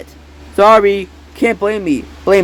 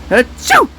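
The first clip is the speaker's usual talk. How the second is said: sneeze; 9 semitones higher than usual. Acted. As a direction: burst out a sick sneeze. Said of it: "I'm sick".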